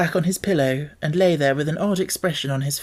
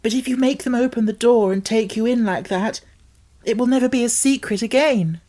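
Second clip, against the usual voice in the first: pleading; 8 semitones higher, 3 dB louder than usual. A narrator's reading. Girl pleading urgently.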